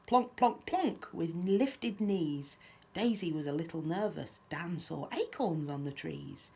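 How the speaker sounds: lively, lilting picture-book reading; children's story narration. A narrator's reading. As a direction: read a rhyme aloud to little ones. Reads picture book aloud.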